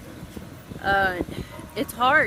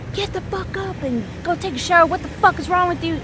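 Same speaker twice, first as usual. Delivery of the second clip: high-pitched exasperated mock yell; yelling at kid; 9 semitones higher than usual. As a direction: re-enact cussing a child into the shower. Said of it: "with me, I was like".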